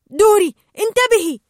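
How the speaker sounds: high loud urgent warning; warning shout. Acted. Marlin warns Dory of the lunging shark, shouting. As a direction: yell to warn her of the shark. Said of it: "فصاح مارلين".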